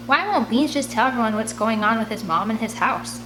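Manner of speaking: plain reading voice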